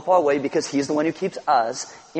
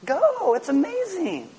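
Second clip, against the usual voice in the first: parent cheering; 12 semitones higher, slower than usual. Pretend. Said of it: "What do you think they're like?".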